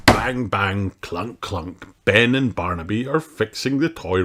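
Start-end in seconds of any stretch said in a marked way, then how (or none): none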